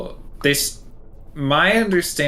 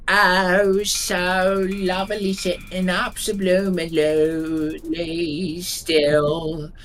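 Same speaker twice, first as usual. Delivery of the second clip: slow sung Cockney, high and drawn out; Cockney accent; 6 semitones higher, much slower than usual. Pretend. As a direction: croon it in a broad Cockney accent. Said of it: "There's a little bit more Coxie for you".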